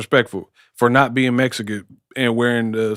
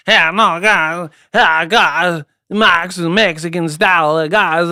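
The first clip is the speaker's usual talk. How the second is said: mock foreign accent, drawn-out vowels, pitched up; imitating a boxer's accent, higher than usual; Triple G; 4 semitones higher, slower than usual. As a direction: exaggerate a Kazakh fighter's accented English. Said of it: "Yeah, Triple G".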